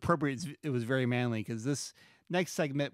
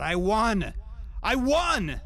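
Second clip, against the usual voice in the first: much higher and louder, frantic protest; desperate cry; 9 semitones higher, 6 dB louder, much slower than usual. Acted. Corbin cries that he won, desperate shout. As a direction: shout it, unbelieving.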